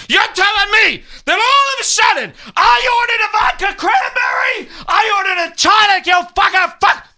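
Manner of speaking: high, loud, rising outrage ending in shouts; furious rant. Acted. Customer raging at the waiter over his drink, shouting.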